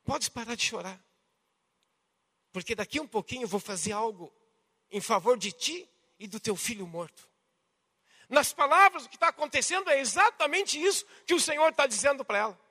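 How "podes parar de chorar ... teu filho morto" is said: soft, low, tender reassurance; gentle consolation. Acted. Jesus consoling the mother of the dead son, tenderly. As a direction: quietly promise help to someone grieving. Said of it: "mas é um consolo".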